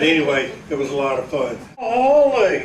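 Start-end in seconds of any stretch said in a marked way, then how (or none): 1.8-2.7 drawn-out, high and swooping Jasper twang; Jasper accent. Acted. Showing off a Jasper impression to the audience, exaggerated Jasper accent. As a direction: exclaim it in a hammed-up Jasper accent. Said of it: "that's my Jasper in-, impression".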